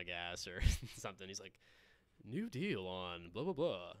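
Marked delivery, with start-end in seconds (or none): 2.2-3.9 lightly voiced radio announcer, slowed right down; a hint of the dad's ad read at the gas pump. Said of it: "He still does advertising".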